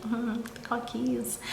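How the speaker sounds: soft, sheepish little hum; quiet, embarrassed murmur. Retold or imitated. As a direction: imitate someone sheepishly owning up. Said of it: "very sheepishly".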